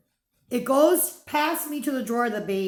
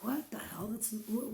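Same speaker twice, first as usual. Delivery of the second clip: whisper; 12 dB quieter than usual. Retold or imitated.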